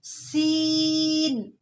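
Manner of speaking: "सीन" with a sharp whistled s, very slow; whistling seen. Shown as a demonstration. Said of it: "बारीक आवाज़ लेकिन सीटी के साथ". ("सीन" सीटी के साथ)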